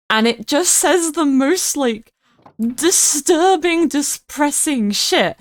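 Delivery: disturbing stretched slow and high; slowed-down emphatic disturbing; dramatic emphasis. Real, not acted. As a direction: drag out disturbing, depressing shit for effect. Describing dark movie lines.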